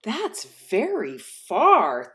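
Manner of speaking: drawn-out amazed little hare; slow wonder. Acted. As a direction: savour how far it is.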